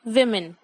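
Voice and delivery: women said "विमिन", both vowels short like i; teacher's model: "women" as "विमिन"; correct pronunciation. Shown as a demonstration. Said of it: "I repeat". (सही उच्चारण: women को "विमिन" बोलें)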